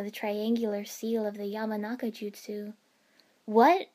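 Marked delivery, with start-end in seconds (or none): 3.4-3.9 loud startled gasp rising. A narrator's reading. Choji seeing Ino's forbidden seal, gasping in shock. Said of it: "Choji gasped".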